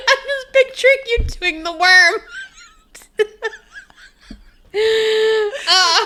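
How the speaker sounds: high, pained laughter; laughing through pain. Real, not acted. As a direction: laugh till it hurts. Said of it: "It hurts to laugh".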